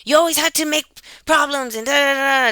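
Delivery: high, mocking complaint trailing into da-da-da; loud mock tirade; imitated going off. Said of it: "Diana starts going off".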